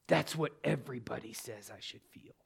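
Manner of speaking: mock outburst held down low; retold angry outburst. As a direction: imitate an angry retort under your breath. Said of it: "she exploded on me".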